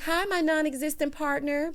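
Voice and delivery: mock passive-aggressive greeting, pitched high; high, needling retold greeting. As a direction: imitate a pointed, fake-friendly hello. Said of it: "being all passive-aggressive".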